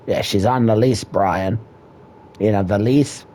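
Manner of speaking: ordinary talk, nothing marked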